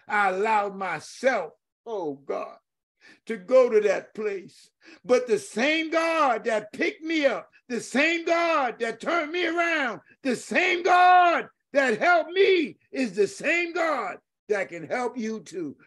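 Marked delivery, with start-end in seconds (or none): 5.0-15.7 much higher, slow, soaring shout; climactic preaching. Real, not acted. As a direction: build to the peak of the sermon.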